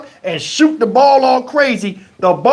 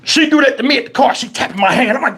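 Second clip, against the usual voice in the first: normal talking voice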